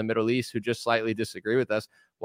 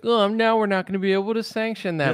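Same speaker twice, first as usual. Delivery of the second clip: higher, whiny mock of an official; mock disappointed official; 8 semitones higher than usual. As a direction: sulk like a let-down politician.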